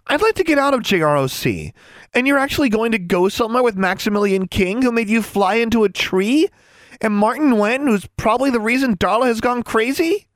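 high-pitched, exasperated rant; exclaiming. A narrator's reading. Anna objecting to her roommate's plan, exclaiming. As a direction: pile up objections, voice pitched right up. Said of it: "Anna exclaimed".

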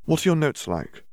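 put-on a studious girl probing; curious Hermione. A narrator's reading. Hermione asks about notes.

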